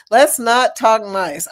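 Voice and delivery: ordinary delivery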